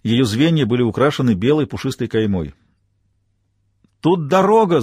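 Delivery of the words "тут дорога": excited shout, raised voice. Acted. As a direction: call out the discovery loudly. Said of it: "закричала Маура".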